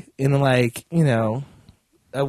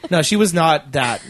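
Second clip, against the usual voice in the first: ordinary talk, nothing marked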